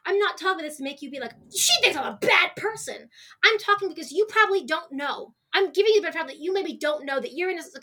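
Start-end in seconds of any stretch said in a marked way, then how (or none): 1.5-3.1 imagined offended listener. Pretend. Imagined listener voiced as quote.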